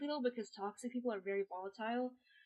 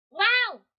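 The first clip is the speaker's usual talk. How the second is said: sudden shrill yelp, very loud; high-pitched "wow" yelp; 12 semitones higher, 18 dB louder than usual. Real, not acted. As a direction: let out a squealing whoop. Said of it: "I have to make that noise sometimes".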